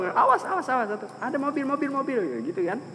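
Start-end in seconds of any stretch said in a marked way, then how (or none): 0.0-0.9 urgent warning. Acted. Passer-by warning Zulfa at once, acted urgent high cry. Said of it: "nggak perlu langsung". 1.2-2.2 high, softer repeated alarm; urgent warning. Acted. Passer-by warning Zulfa of a car, acted high alarm. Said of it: "nggak perlu langsung".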